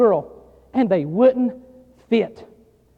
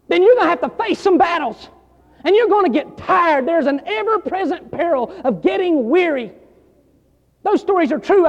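louder, urgent pulpit delivery; full-force preaching; 5 semitones higher, 4 dB louder than usual. Acted. Preacher warning young pastors about weariness, at full force.

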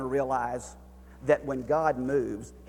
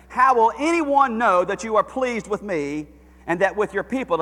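high, loud preacher's cry to God; impassioned sermon climax; full-force preaching; 8 semitones higher, 11 dB louder than usual. Acted. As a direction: plead it from the pulpit, full voice.

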